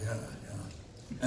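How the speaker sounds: low, soft, offhand murmur; a mumbled, uninterested yeah yeah; dismissive mumble. Retold or imitated. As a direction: brush him off under your breath. Harrison Ford's dismissive reply.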